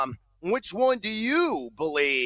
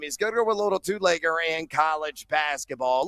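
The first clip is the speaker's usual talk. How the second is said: ordinary delivery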